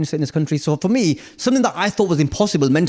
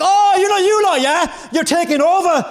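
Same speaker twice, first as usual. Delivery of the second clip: mock local guy; 13 semitones higher than usual. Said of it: "He was like".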